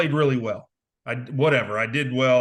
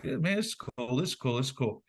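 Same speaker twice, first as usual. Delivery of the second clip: softer, half-hearted "this is cool" repeated; offhand mock enthusiasm; 6 dB quieter than usual. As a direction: mumble it like you're skimming letters. Reading recruiting letters, casually unimpressed.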